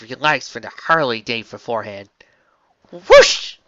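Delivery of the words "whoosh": very high loud breath out; relieved whoosh. Acted. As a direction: exhale with relief. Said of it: "Applejack breathed".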